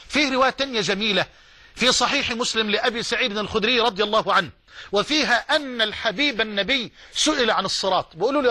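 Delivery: ordinary delivery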